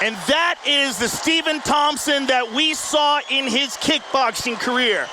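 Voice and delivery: shouted play-by-play praise; excited shout. Acted. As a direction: call it out like a big moment. Excited commentator praising fighter.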